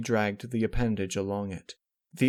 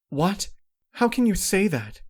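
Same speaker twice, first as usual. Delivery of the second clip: high, loud, stunned protest; shocked disbelief; 11 semitones higher, 7 dB louder than usual. Acted. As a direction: burst out in disbelief. Shocked reply to sister.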